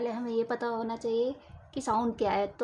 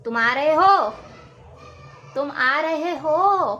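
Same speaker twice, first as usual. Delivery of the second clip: loud, higher call, second take slow; high pitch; 4 semitones higher, 7 dB louder, slower than usual. Shown as a demonstration. Teacher demonstrating high pitch intonation, loud and high. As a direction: lift the voice up high. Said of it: "अगर मैं high pitch में बोलूँ".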